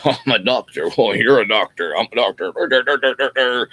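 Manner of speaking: smug, mock-important doctor voice; pompous doctors. Doctors boasting to each other in a smug mock voice. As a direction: puff up like a self-satisfied doctor.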